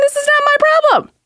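very high, indignant squeak. Retold or imitated. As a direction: huff it out, outraged. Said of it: "I'm like".

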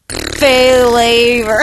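loud drawn-out announcer. Pretend. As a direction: boom it like a TV host. Announcer-style catchphrase.

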